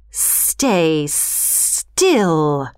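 low snake hiss on the words. A narrator's reading. Python Kaa hisses at the monkey crowd. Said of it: "Kaa hissed".

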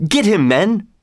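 high storybook rallying call; narrator's mild, high command; crying out. A narrator's reading. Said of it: "cried Will Stutely".

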